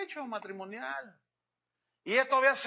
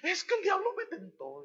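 high, tearful, sobbing plea, much higher; crying woman; 9 semitones higher than usual. Retold or imitated. Unfaithful wife thanking her husband for forgiveness, crying. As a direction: whimper it like a repentant wife in tears. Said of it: "Y ella lloraba".

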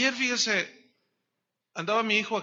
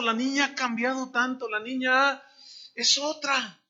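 tearful mother; 5 semitones higher, 4 dB louder than usual. Retold or imitated. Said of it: "Y llorando la señora".

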